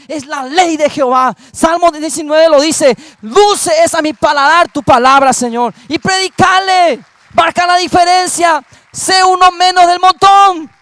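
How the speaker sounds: loud, building sermon delivery; fiery preaching. Acted. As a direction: preach it hard to the church. Preaching to the congregation. (predicación fervorosa y fuerte)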